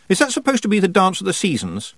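a light hint of a curious girl; lightly voiced puzzled girl. A narrator's reading. Tiffany asking Miss Treason about the title, lightly puzzled.

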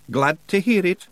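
high, loud Scots pixie voice; brash wee Scottish fighter; Feegle voice. Acted. Rob Anybody brushing off Roland's doubts, high and brash.

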